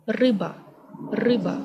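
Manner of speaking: RYBA opening on a trilled r, "RRY-ba"; rolled R. Shown as a demonstration. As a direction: roll the first sound of "ryba", stress up front. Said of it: "roll this letter inside your mouth".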